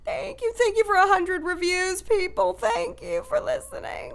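high, slow cartoon dog voice; squeaky silly dog thanks.

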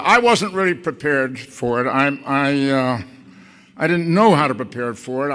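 ordinary delivery

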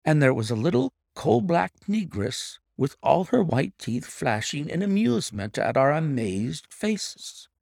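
usual speaking voice

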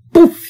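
a sudden loud high "Puf!"; loud mock impact noise; puff sound effect. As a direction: burst out the "Puf!" like a jolt to the heart. Heart jolt under anesthesia.

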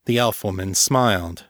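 plain reading voice

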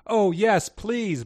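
high, loud eager plea; eager bark. A narrator's reading. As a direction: answer like a pack of keen dogs. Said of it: "barked many dogs".

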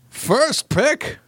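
high-pitched, badly faked captain voice; Picard. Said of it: "in the voice of my Picard".

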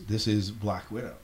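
normal talking voice

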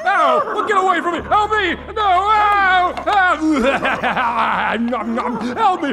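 high, loud screams for help; panicked screaming. Acted. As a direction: scream as if being eaten alive. Teacher attacked by monster.

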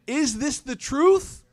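high, slow, drawn-out disbelief; shocked question. Acted. Akhnas questioning Abu Jahl about the recitation, shocked. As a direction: ask it stunned, pitch lifted. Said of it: "They look at him shocked".

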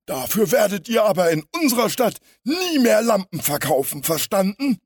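high, loud warning from a burly crook; threatening villain. A narrator's reading. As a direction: threaten loudly in a high-pitched henchman voice. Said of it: "raunte der Stämmige Louis an". (drohende, hohe Schurkenstimme)

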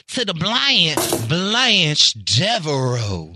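very slow, drawn-out Southern belle Blanche Devereaux; hammed-up Southern drawl on Blanche Devereaux; spicy Southern accent. Shown as a demonstration. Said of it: "throw a little more spicy seasoning".

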